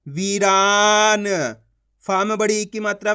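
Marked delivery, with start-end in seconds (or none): 0.1-1.3 वीरान very slowly, long वी and रा; slow sounding-out of वीरान for learners; stretched vowels. Shown as a demonstration. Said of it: "खींच खींच के उच्चारण करना है".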